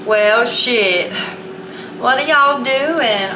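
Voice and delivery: Southern drawl impression, pitch lifting on the question; imitated Southern belle accent, stretched out; Leslie Jordan. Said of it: "Southern Belle accent as well ... Leslie Jordan".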